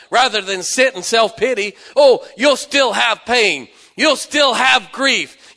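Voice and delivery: fiery pulpit crescendo; preaching at full force. Acted. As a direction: drive it home to the congregation.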